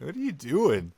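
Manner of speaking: high-pitched, incredulous questioning; baffled disbelief. Acted. Baffled reaction to odd walking.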